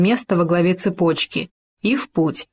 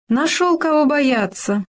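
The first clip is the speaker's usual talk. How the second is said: higher, faked amusement; fake laugh; 8 semitones higher than usual. A narrator's reading. Fox laughing falsely at Khoma's fear, higher. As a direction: pretend to laugh it off. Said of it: "притворно рассмеялась лиса".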